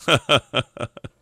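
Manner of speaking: short chuckling laugh; amused laugh. Real, not acted. Speaker amused at how his perception changed, chuckling. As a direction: chuckle at your own fussing.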